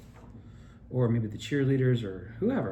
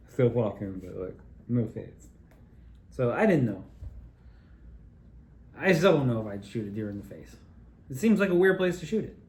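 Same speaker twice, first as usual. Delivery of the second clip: ordinary delivery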